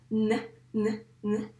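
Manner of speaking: N as a short isolated "nn"; letter sound N. Shown as a demonstration. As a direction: sound out N for a learner. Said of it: "la lettre N se prononce". (N prononcé « nnn », pas « èn »)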